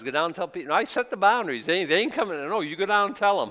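a hammed-up God pushing Moses back down; God's voice. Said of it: "God says".

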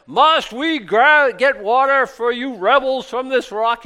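loud, high, hammed-up angry shout; riled-up Moses. Preacher acts Moses berating the thirsty rebels, riled-up shouting. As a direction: bellow slowly at the crowd in mock fury. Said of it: "he's all riled up".

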